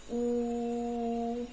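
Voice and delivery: ऊ held long on one steady note; drawn-out, level ऊ; long vowel. Shown as a demonstration. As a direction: stretch ऊ out as a sustained "oo". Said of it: "लंबा जाएगा".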